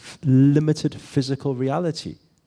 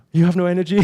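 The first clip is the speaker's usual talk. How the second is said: higher, louder astonished line; mock shock; 6 semitones higher, 5 dB louder, faster than usual. Friend marvelling that more sleep left no energy, hammed-up surprise. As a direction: exaggerate the friend's amazement.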